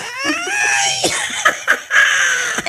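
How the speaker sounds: high burst of laughter; big, high-pitched cackle; loud shrieking laugh. Acted. Reacting to the crude joke.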